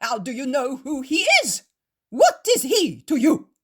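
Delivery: loud, high furious accusations; shouting. Acted. Proud Pharisees accuse the host, furious shouting. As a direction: hurl it at him like an outraged zealot.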